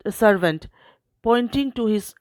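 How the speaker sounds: ordinary delivery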